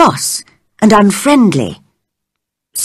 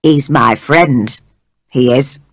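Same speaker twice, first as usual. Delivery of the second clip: put-on Yorkshire old man; 6 semitones lower than usual. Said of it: "He spoke in strong Yorkshire dialect".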